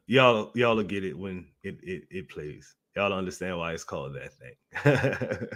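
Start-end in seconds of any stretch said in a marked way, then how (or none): none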